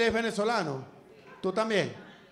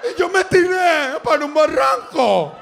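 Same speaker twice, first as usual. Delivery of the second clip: laughing loud and high through the story; cracking up while telling it; high, loud, laughing retelling; 6 semitones higher, 8 dB louder, slower than usual. Retold or imitated. Retelling shooting at border, laughing.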